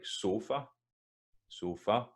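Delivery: sofa with the unstressed last vowel still an "a"; clear final a. Shown as a demonstration. Teacher showing the unstressed vowel, sofa with audible final a. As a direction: say sofa slowly, keeping the end vowel recognisable. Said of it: "it's still a recognisable a sound".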